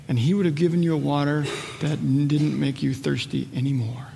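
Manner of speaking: low, soft retelling of scripture; hushed preaching. Real, not acted. Jesus speaking to the Samaritan woman at the well, hushed. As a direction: let it sink toward a near whisper.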